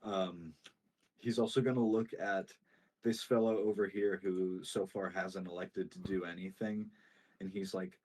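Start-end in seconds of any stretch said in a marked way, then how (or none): none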